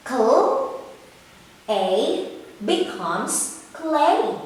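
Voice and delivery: slow sounding out, then "clay" said whole; blending sounds. Shown as a demonstration. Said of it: "How about this one".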